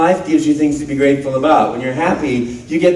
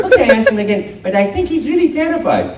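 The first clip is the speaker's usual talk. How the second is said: high, amused plumber; Mario voice; 9 semitones higher than usual. Retold or imitated. Mario agreeing to ask Luigi again, a high amused voice. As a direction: humor the kid as Mario. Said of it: "and they say".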